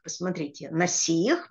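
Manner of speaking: usual speaking voice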